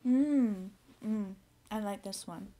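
humming while chewing; talking between bites; mouth full, chewing. Real, not acted. Seen 2: Bitten roll in hand; closed-lip chewing while humming approval.

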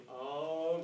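ordinary talk, nothing marked

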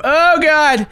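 high, loud exclamation of fright; alarmed cry. Real, not acted. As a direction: blurt it out in panic. Sudden scare in game.

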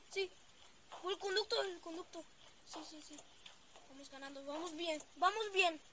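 usual speaking voice

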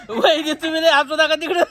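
high-pitched, helpless laughing plea. Real, not acted. Telling sister-in-law to stop laughing, while laughing. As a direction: plead through real giggles.

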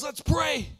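loud, high, urgent; rally him to prayer now; urgent call to pray. Retold or imitated. Tennis buddy urgently calling for prayer over the broken foot. Friend responds to injury.

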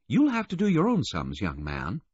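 high woman's voice, dropping at the end; Missus Baudelaire, prim. A narrator's reading. Missus Baudelaire refusing to convert to miles, high and prim. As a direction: gently put him in his place, high-pitched.

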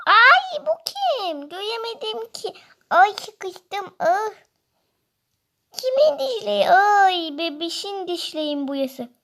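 put-on high, startled baby squeal; squeaky startled baby. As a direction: shriek it like a frightened toddler.

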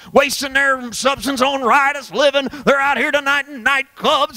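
high, driving preacher's cry; full-force preaching. Acted. Preacher crying out to the congregation, full force. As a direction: preach it hard to the crowd.

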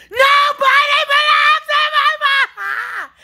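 high, slow, half-sung scream; slow wailing scream. Real, not acted. Ordering the spirits out, wailing scream. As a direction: wail each word out. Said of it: "This yelling and screaming".

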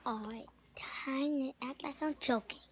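a sneaky whisper to himself; whispered aside. Real, not acted. Kid plotting before fake choking, whispered. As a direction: whisper it, unvoiced and secret.

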